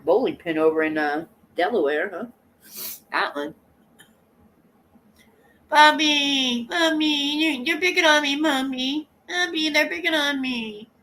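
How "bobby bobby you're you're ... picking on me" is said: high, loud mock whine; imitating a complaining woman. Mimicking her tattling that she's picked on, high mock whine. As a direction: whine for help in her put-on voice. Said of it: "Don't I sound like her".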